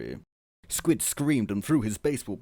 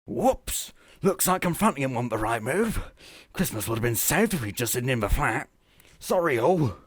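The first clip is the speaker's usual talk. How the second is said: higher, brisk oops voice; the Doctor apologises for his mistake; sheepish apology; 4 semitones higher, 3 dB louder than usual. Acted. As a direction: shrug it off awkwardly. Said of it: "apologized the Doctor".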